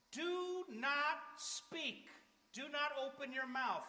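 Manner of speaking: very slow, each word hammered; "Do not speak" dragged out and punched; emphatic warning. Acted. Preacher warning the congregation, slow and emphatic.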